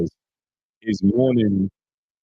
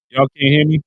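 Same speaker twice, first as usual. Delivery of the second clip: raised voice, incredulous; Y'all can't hear me, louder, in disbelief; louder surprised; 6 semitones higher, 6 dB louder than usual. Real, not acted. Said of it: "We can't hear you".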